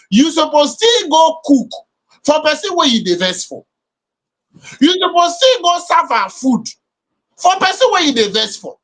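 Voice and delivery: high, rising, insistent complaint; heated emphasis. Real, not acted. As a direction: press each point, exasperated. Heated argument about married couples.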